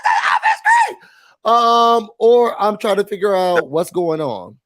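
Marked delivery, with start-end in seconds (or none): 0.0-1.0 very high, loud excited shouting; shrieking fan cheering at the TV; screaming at the TV. Retold or imitated. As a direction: cheer at the screen like a superfan. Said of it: "I'm just yelling and screaming like".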